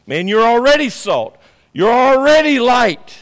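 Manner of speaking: loud, high, slowed preaching; fiery pulpit declaration; full-force preaching. Acted. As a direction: thunder it out to the congregation. Preaching climax.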